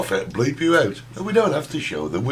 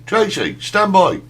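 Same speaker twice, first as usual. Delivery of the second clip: raised voice, summoning Tracy; calling out; 6 semitones higher, 4 dB louder, slower than usual. Real, not acted. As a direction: call her over, louder.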